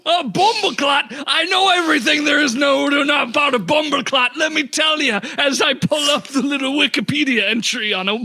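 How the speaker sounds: hammed-up Jamaican know-it-all; Jamaican accent. Shopkeeper claiming to know all about bumbleclot, Jamaican accent. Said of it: "Jamaican accent".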